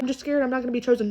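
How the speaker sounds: ordinary delivery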